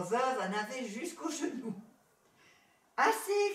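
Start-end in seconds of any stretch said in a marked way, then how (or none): 3.0-3.5 loud, high desperate shout; frantic high cry. A narrator's reading. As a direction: shout it high to halt the flood. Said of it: "criait-elle".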